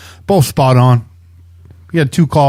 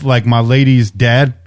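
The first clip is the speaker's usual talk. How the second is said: normal talking voice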